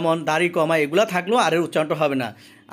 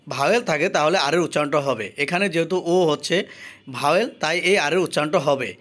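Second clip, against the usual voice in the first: ordinary talk, nothing marked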